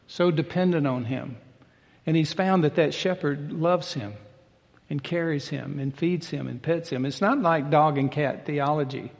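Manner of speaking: usual speaking voice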